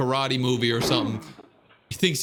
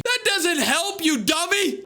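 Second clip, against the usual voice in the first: mocking; 14 semitones higher, slower than usual. Real, not acted. Mocking the man on video.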